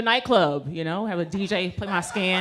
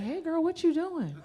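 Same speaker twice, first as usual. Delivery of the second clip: high, lilting retold greeting; sing-song greeting; 9 semitones higher, 4 dB quieter than usual. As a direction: swoop up high, casual and nosy. Greeting patient in retold scene.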